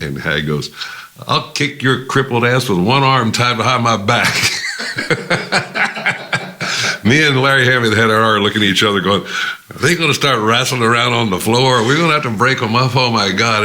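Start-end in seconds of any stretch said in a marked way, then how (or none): 1.3-4.3 loud, high, threatening roar; furious threat. Retold or imitated. Hag threatening the label boss, loud furious yell. 4.4-7.0 high unvoiced burst of laughter. Real, not acted. Telling the story of the fight, bursting out laughing. 7.0-13.6 loud, high, fast alarmed telling; excited alarm. Retold or imitated. Watching the fight break out, loud alarmed rush.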